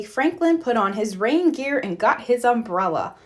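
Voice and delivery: ordinary delivery, nothing marked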